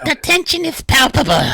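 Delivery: high, loud hammy announcement; put-on dramatic voice. Game master breaking the tension of battle, hammed-up voice. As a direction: blare it out in a silly, strained character voice.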